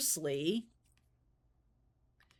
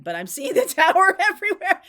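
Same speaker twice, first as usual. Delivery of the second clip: laughing exclamation; 10 semitones higher, 10 dB louder than usual. Real, not acted. Laughing at the Tower again.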